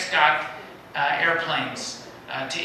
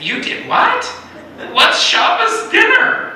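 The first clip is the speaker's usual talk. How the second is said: much higher and louder, incredulous; shocked disbelief; 8 dB louder, slower than usual. Retold or imitated. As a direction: squeal it in total disbelief, slowing on dinner. Staff's shocked reaction, retold.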